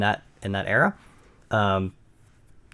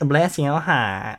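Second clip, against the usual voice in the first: put-on slow, drawn-out Southern accent; 4 semitones higher, much slower than usual. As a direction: drawl it like a Southern belle. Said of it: "don't do that anymore".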